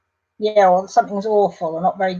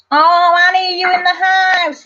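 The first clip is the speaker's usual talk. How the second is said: loud, high call across the house; real call to someone in another room; 9 semitones higher, 6 dB louder than usual. Real, not acted. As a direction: shout up to her cheerfully. Calling out to Annie.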